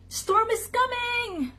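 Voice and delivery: high, urgent animal cry; high-pitched alarmed announcement; chorus alarm. A narrator's reading. All animals cry the warning.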